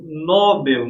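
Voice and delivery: "Nobel" stressed on the first syllable, NÓ-bel; "Nobel" mispronounced as NÓ-bel; wrong stress, NÓ-bel. Shown as a demonstration. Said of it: "não é Nobel".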